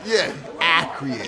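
acreate, drawn out with a laugh; acreate mispronunciation. Retold or imitated. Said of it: "when he says acreate".

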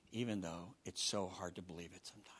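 breathy whisper; whispered confession. Acted. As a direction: confide it softly. Intimate confession.

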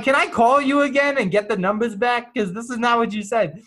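high, loud mock protest; indignant complaint. To the nurse about the changed test time, a high loud mock protest. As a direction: argue like you've been cheated.

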